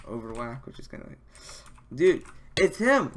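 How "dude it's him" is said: excited. Real, not acted. Spotting famous streamer in game.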